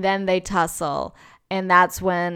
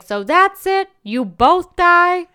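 high, loud, incredulous mock yell; retold yelling; 11 semitones higher, 8 dB louder, much slower than usual. Retelling her outburst at both fighters dying, yelling. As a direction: yell it in disbelief, drawing out the end. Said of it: "I was yelling at this point".

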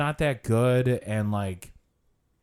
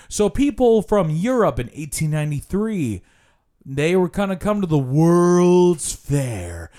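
mock guide, stretching out the key words; imitating a slow, drawn-out lecturer; tour guide's delivery. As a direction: drag it out to fill time. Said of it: "this is how he talked".

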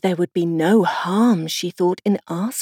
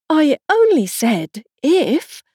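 slow, higher, pleading plea; piteous pleading; 8 semitones higher, 4 dB louder, slower than usual. Acted. Alice pleading with the queens in a piteous tone. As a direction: beg to be understood, slowly. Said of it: "poor Alice pleaded in a piteous tone".

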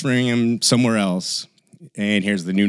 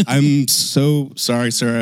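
embarrassed apology; 6 semitones higher, 5 dB louder, slower than usual. Retold or imitated. Apologizing to stranger.

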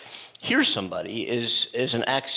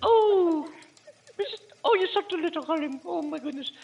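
much higher, cooing nanny voice; Mrs Doubtfire voice. Retold or imitated. Host cooing at a darling as the nanny. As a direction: dote on someone like a sweet old nanny. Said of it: "Mister Doubtfire".

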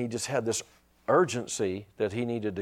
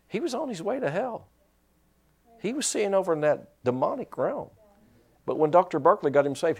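ordinary delivery

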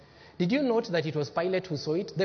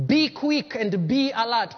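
ordinary delivery

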